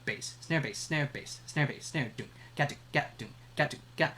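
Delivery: chanted bass snare then mouthed doom ga; beatbox the dembow. Shown as a demonstration. Teacher chants the dembow beat for the class, mouthed drums. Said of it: "That's the dembow rhythm".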